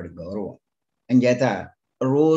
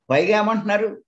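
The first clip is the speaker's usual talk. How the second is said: normal talking voice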